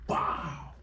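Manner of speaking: breathy mock wow in her voice; whispered sarcastic wow. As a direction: play the girl, unimpressed. Girl reacts mockingly.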